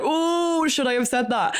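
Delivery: a sudden high "oh" of imitated alarm at having said too much; mock-panicked second thoughts, high and quick; worried slip. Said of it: "you could tell in his voice".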